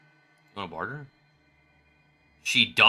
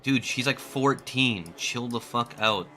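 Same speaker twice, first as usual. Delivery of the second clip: normal talking voice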